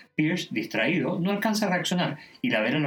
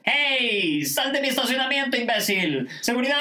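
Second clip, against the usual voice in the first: angry yelling; 11 semitones higher, 3 dB louder than usual. Acted. Chang ordering Pierce out of his parking spot, angry yell. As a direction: holler indignantly, stretching the call for security.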